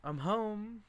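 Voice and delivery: high sing-song call of arrival; calling home. Acted. Meg arriving home, calling to the house. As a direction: call it out as you walk in. Meg's first line, called out.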